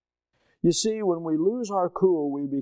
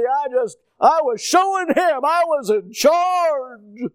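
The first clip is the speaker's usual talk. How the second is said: high, loud, drawn-out declaration; heated boast; 9 semitones higher, 5 dB louder, slower than usual. Retold or imitated. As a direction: proclaim it hotly, stretching the words. Reenacting his heated outburst.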